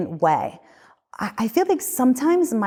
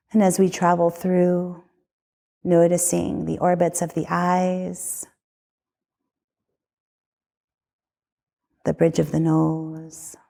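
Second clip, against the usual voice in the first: guided meditation. Acted. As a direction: lead them gently through the body. Leads a guided body scan.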